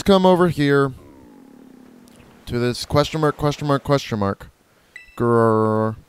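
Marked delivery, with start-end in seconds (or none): 5.2-6.0 low mock growl "grrr". Gamer voicing the weak Pokemon with a low mock growl.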